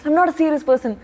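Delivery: playfully wounded denial; mock offended. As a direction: imitate someone taking offence at the charge. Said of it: "he was very offended".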